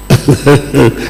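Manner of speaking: brief laugh at the memory; short chuckle. Retold or imitated. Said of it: "I just laughed".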